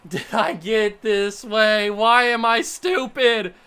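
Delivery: loud, drawn-out wail of frustration; anguished self-berating. Real, not acted. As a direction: wail at yourself, slow and loud. Berates self after losing.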